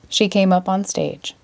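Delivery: ordinary delivery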